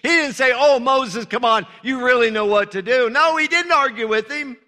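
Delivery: fervent preacher rising to a peak; preaching at full force. Acted. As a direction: drive the point home to the congregation.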